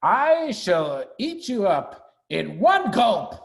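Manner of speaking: high, needling threat, slowing on the last line; a small creature making big threats; squeaky rat bluffing menace; menacing Rat. Acted. Rat threatening Mister Fox.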